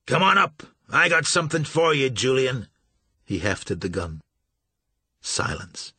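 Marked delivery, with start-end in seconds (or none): 0.1-2.7 loud defiant call; challenging shout. A narrator's reading. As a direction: shout the dare into the dark. Marsh challenging hidden vampires.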